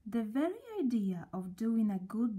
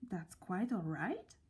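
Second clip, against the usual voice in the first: softer, wondering repeat to himself; puzzled to himself; 3 semitones lower, 5 dB quieter than usual. A narrator's reading. Mister Good musing after the stranger's kind words, softly puzzled. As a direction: turn it over in disbelief, quietly. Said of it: "repeated Mister Good to himself".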